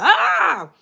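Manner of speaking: sudden high, loud "ah"; high yelp as a quick demonstration; demonstrated yell. Shown as a demonstration. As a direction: let out a mock sleep-yell. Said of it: "I may just yell something out".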